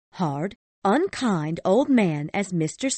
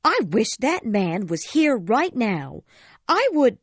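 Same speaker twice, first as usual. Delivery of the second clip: higher, heated outburst rising at the cut-off; angry wife; 13 semitones higher, 5 dB louder than usual. A narrator's reading. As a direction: spit out her grudge. Mrs Cratchit's anger continues.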